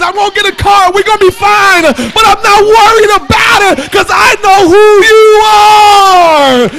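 building to a high, loud, drawn-out shout; full-force preaching. Real, not acted. Preacher declares faith in God despite no car, full-force preaching. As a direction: cry out each declaration at the sermon's climax.

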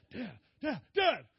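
insistent, climbing to a high, loud final Dad; a kid tugging for attention; calling out. Retold or imitated. Son tugging his dad's coat among ministers, urgent calling.

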